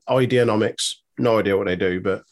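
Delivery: normal talking voice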